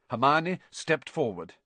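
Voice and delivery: ordinary delivery, nothing marked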